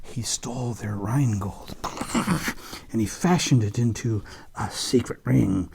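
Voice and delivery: normal talking voice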